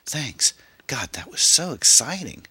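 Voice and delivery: put-on high, excited young passenger; thrilled. A narrator's reading. Passenger thanking driver after the escape, thrilled. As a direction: gush it high and loud.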